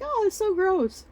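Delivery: put-on high-pitched kid complaint; disgusted whine. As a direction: imitate a child protesting a nasty taste. Child complaining about the taste.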